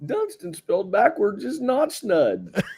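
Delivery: put-on high, slow old broadcaster voice; Harry Carey impression. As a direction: drawl it like a tipsy ballpark announcer.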